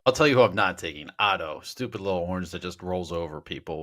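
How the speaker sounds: usual speaking voice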